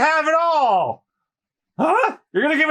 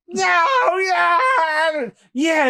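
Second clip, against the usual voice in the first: triumphant shout; 6 semitones higher, much slower than usual. Acted.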